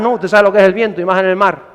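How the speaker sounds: ordinary talk, nothing marked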